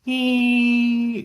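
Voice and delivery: high-pitched electronic whine; defibrillator whine. Retold or imitated. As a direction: squeal it like a charging defibrillator. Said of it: "whine noise?".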